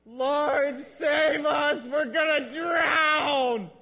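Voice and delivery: screaming high and loud in terror; panicked shout. Shown as a demonstration. Disciple screaming to Jesus in the storm, panicked shout. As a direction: yell it like waves are swamping the boat. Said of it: "that's the way that I would say it if I'm standing in the boat".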